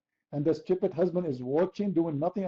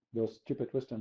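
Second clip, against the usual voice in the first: usual speaking voice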